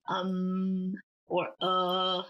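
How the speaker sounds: low, drawn-out "um" and "uh"; hesitating. Shown as a demonstration. Teacher likening third tone to hesitation, "um", "uh" low. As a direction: hold "um" and "uh" at the bottom of your pitch. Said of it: "like a hesitating".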